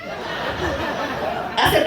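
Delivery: ordinary talk, nothing marked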